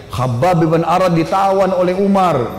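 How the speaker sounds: normal talking voice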